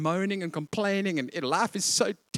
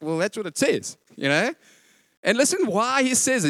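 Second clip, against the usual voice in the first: ordinary delivery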